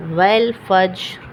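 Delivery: والفجر very slow, final ر stopped but lightly sounded. Shown as a demonstration. Teacher recites والفجر slowly so students hear the faint ر. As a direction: draw out والفجر and let its ر ring just faintly. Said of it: "را کی آواز نکلے گی لیکن معمولی".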